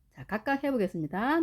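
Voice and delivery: ordinary talk, nothing marked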